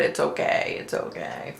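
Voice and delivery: fading soft reassurance; soothing. Retold or imitated. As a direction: hush her gently, dying away. Comforting the upset sister.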